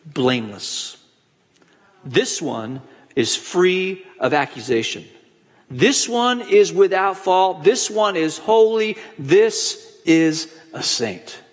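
slow, weighty pronouncement with long pauses; God's solemn verdict. Acted. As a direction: deliver it as a measured divine judgment. Preacher voices God the Father's verdict.

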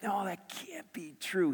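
soft, high, drawn-out protest; hushed disbelief. Retold or imitated. Father answering the Holy Spirit, hushed disbelief. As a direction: softly marvel in slow disbelief.

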